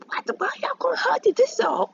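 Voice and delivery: very high, rushed squeal of confusion; baffled squeal. Acted. Goku confused by the family hug, baffled squeal. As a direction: squeak it out fast in mock bewilderment.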